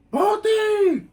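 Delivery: high, loud call across the block. Retold or imitated. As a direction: yell the nickname from far away. Best friend calling her name.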